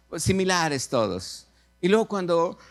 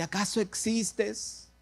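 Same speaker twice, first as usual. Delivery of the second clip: higher, softer, slow questioning of God; doubting plea; 5 semitones higher, 5 dB quieter, slower than usual. Acted. Young woman in a sketch asking God if He exists, doubting plea. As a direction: put it to heaven slowly and softly.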